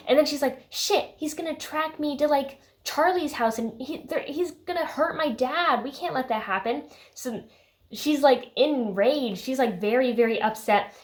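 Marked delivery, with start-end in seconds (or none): 0.7-6.8 high-pitched worried retelling; lightly acted alarm, voiced high; mock panic. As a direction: fret it out in a raised pitch. Acted panic of Bella retold.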